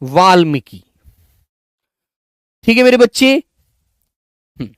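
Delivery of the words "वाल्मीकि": वाल्मीकि said plainly and firmly; teacher repeating for the class; model pronunciation. Shown as a demonstration. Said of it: "समझे आप लोग".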